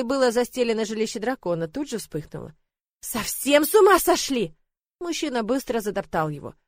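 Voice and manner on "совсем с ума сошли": slow, loud, higher exclamation; exasperated outburst. Acted. Lord shouting at the fire-spitting dragon, exasperated. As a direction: blurt it out in alarm.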